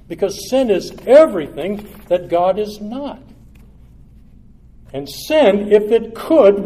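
loud, high preaching at full force; fiery preaching. Acted. Preacher denouncing sin to his congregation, loud and fiery. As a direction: thunder it from the pulpit.

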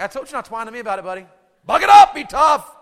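rapid scolding rising to a high shout; loud mock tough-dad telling-off. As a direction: bark it like an unsympathetic coach. Dad scolding his bleeding son.

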